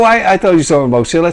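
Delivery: usual speaking voice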